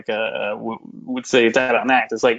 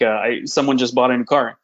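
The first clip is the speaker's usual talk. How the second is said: usual speaking voice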